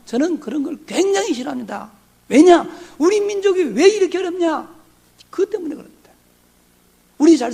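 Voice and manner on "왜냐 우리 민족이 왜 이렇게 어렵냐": 왜냐? thrown out loud and sharp; loud challenge. Shown as a demonstration. Pastor loudly challenging the congregation with 왜냐?. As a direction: fire 왜냐? at the hall like a challenge.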